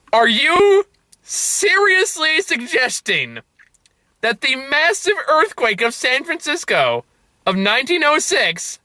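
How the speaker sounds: high, drawn-out disbelief; utterly incredulous, slow and high; incredulous outburst. Retold or imitated. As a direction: stretch each phrase out in outraged, high-pitched disbelief. Incredulous reaction to the film's ending.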